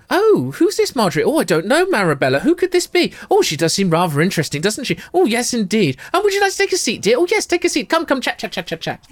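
high, gushing posh-lady voices; high, sing-song gossip between two twins; gossiping society twins. Acted. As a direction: trade lines like two twittering ladies. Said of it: "they kind of look up and go".

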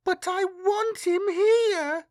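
put-on high, slow child's whine of protest; petulant. A narrator's reading. Girl protests when refused her friend, petulant child voice. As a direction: insist like a sulky little girl. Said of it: "she said petulantly".

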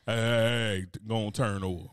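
lightly voiced lover ordering him over; a touch of a low, commanding murmur; bossy bedroom voice. Imitating his partner in bed, low commanding murmur. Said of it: "Fox be coming over there".

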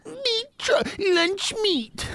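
high silly voice. Pretend. Silly high voice for Meat Truck.

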